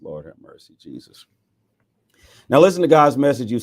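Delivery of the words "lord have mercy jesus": much softer and lower Lord have mercy; hushed aside. Real, not acted. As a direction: breathe it out under your breath. Hushed aside after baby talk.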